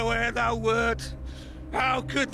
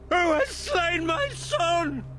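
grieving father's lament; 7 semitones higher than usual. Acted. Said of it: "to drown thee with an ocean of my tears".

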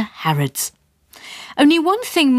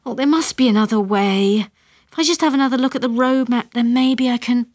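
flustered; 6 semitones higher than usual. A narrator's reading. Mother frantic over map.

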